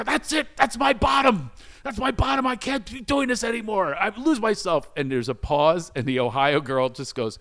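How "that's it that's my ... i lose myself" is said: high-pitched, overwrought outburst; re-enacted meltdown. Driver giving up before the kids, in high retold meltdown. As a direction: declare it over in a raised, strained wail. Acts out breakdown in the car.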